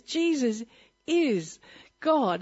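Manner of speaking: normal talking voice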